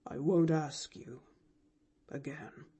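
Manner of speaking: fading to a near-silent murmur; almost inaudible. A narrator's reading. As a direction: let the last word die away. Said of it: "so low it was almost inaudible".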